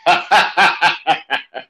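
loud, booming villain cackle; evil villain laugh. Acted. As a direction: laugh like a cartoon bad guy. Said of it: "I had to do my evil villain laugh".

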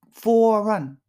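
"for a run" run together as "fora run"; linked for a. Shown as a demonstration. Teacher demonstrates linking "for a". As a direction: join "for a" into one sound. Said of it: "くっつけちゃう".

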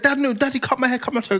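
high-pitched mock pleading; put-on little boy's protest; imitated pleading child. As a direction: mimic a kid begging Daddy. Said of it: "he's like".

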